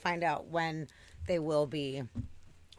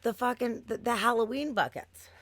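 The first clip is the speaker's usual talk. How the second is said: louder, higher, insisting; insistent excitement; 7 semitones higher, 5 dB louder than usual. Retold or imitated. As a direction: push it like an eager customer who won't let go. Insisting on the Halloween buckets.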